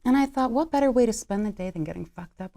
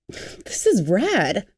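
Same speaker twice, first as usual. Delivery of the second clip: excited; 4 dB louder, slower than usual. Acted. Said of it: "grabs the Walkman excitedly".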